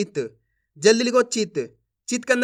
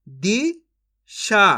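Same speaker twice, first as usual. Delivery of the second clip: दिशा very slowly split as "दी ... शा"; syllable by syllable; much slower than usual. Shown as a demonstration. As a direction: sound out Disha in two separate pieces. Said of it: "एक अक्षर का उच्चारण करके". (दिशा को तोड़कर, धीरे-धीरे)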